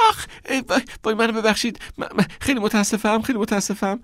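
high, fast, hammed-up sorry; fake apology. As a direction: overdo the apology after faking a stumble. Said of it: "وانمود کرد که سکندری خورده".

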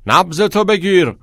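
high, loud coach's yell; shouted order. A narrator's reading. Coach to one of the boys, shouting. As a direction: call it out hard to a runner. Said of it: "رو به یکی از پسرها داد زد".

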